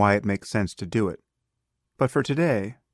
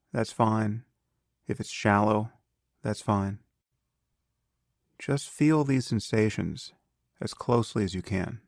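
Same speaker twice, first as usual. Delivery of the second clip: calm, unhurried meditation guide with long pauses; guided meditation. Acted. Teacher leading a beginner through breath awareness, calm guided meditation.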